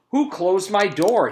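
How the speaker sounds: high, loud, slow outraged question; indignant demand. Acted. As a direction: demand to know who did it. Principal bumps closed door.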